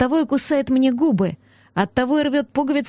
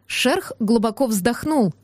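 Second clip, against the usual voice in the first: ordinary delivery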